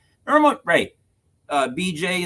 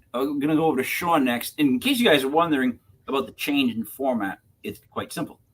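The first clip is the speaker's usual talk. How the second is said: usual speaking voice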